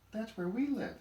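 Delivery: put-on high, loud child's voice; excited child. A narrator's reading. Kid interrupts grandpa's story, excited and high. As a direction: pipe up like an eager kid.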